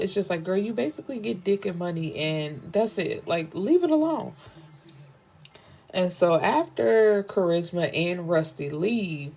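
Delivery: normal talking voice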